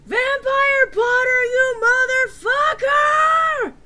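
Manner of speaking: slow drawn-out high yell; screamed at full force; hammy stage actor. Acted. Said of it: "I yelled".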